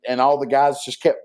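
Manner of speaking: ordinary talk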